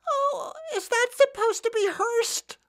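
put-on very high, slow little-kid voice; childish voice. Comic recalling seeing Citizen Kane as a child, high kid voice. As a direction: squeak it like a small child. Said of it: "I said like that in a childish voice".

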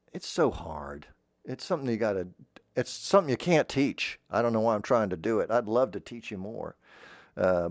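normal talking voice

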